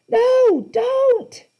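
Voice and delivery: loud, very high scream. A narrator's reading. Annabeth begs Percy not to look, screaming. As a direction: scream in panic. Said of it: "Annabeth screamed".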